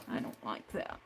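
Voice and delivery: soft unvoiced complaint to herself; whispered aside. Real, not acted. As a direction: breathe it out under your breath, annoyed. Muttered aside over gummed scissors.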